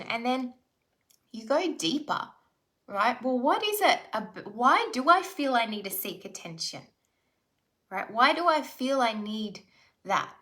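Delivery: normal talking voice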